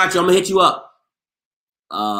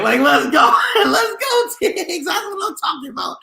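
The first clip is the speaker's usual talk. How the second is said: laughing hype cheer; 13 semitones higher than usual. Real, not acted. Cheering after Stiggs shout-out.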